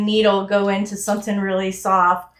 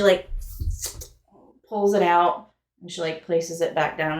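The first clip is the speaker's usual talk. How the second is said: normal talking voice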